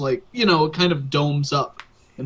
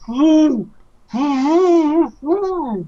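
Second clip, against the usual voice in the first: high, distorted underwater booming wail; magically amplified voice; 10 semitones higher, 5 dB louder, much slower than usual. Acted. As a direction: wail it like a garbled voice through water. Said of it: "from under the water you hear".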